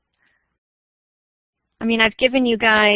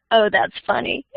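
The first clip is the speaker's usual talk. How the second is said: ordinary delivery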